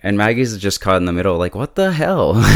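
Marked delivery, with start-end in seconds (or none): 1.5-2.4 jumps up high in mock disbelief; a quick, high, startled outburst; startled what the hell. Caught between fighting sisters, a high startled outburst.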